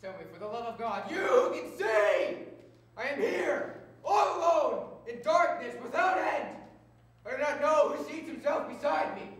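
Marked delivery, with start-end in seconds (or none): 1.1-9.3 high, very loud desperate shouting; anguished outburst. Acted. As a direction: cry out from lonely, blind darkness. Blind man's anguished outburst.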